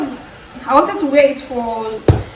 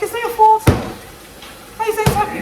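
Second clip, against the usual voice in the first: higher, a light mock rebuke; colleague's retort; 9 semitones higher than usual. Colleague questioning her apology, higher light mock rebuke.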